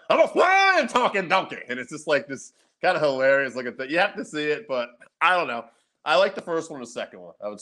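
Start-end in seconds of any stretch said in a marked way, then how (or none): 0.1-1.6 high, loud cartoon donkey voice; donkey character. Retelling the floating donkey scene, hammed-up cartoon donkey. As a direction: squeal it like a delighted cartoon donkey. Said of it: "He's like".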